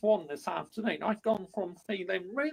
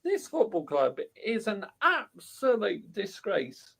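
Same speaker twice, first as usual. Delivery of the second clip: ordinary talk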